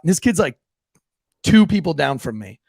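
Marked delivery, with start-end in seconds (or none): none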